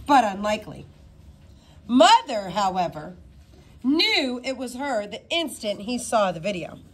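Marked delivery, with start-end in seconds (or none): none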